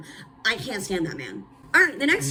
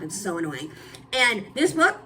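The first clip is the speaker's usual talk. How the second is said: normal talking voice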